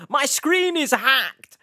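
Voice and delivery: high, loud cry of alarm; hammed-up horror-film victim shouting; mock panic. Reactor mocking the scary hacker intro, mock panic.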